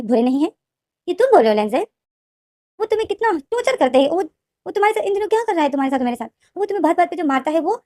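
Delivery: rising, fast indignant protest; angry outburst. A narrator's reading. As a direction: voice climbs high in disbelief and anger. Wing भाई पर भड़कता है. (ऊँची, तेज़ नाराज़ आवाज़)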